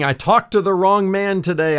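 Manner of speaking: a hint of a frightened fortune teller; panicked exclamation. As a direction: blurt it while fleeing the streetcar. Fortune teller flees the streetcar.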